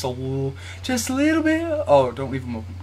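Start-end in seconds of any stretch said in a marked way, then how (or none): none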